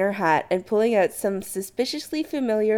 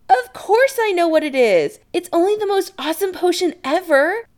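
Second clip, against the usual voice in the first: high, loud, gushing enthusiasm; excited; 12 semitones higher, 6 dB louder than usual. Acted. Adam to Chris about the potion, excited. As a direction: gush about it, pitch way up. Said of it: "Adam says excitedly".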